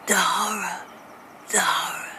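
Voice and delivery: hushed, breath-only film whisper; haunted whisper. Acted. Brit quoting American films, dying haunted whisper. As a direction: whisper it with dread, pausing between. Acting out famous film line.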